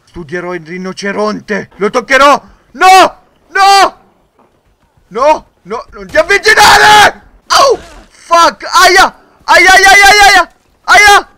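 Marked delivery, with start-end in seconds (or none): 2.7-11.3 high, loud panicked screams; panicked screaming. Real, not acted. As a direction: yell in real panic as the rhino charges. Rhino attacks the player. (urla di panico, acute e forti)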